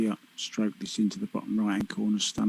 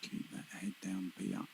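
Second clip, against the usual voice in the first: soft, hushed urging; 2 semitones lower, 13 dB quieter than usual. Real, not acted. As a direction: hush it like a golf commentator. Hushed urging during the shot.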